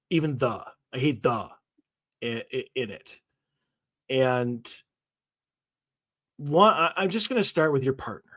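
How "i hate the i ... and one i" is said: ordinary talk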